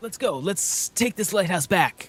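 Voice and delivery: rousing hero's rallying call; earnest anime hero. Acted. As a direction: lead the charge like a shonen lead. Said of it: "Dante really does just talk like an anime protagonist".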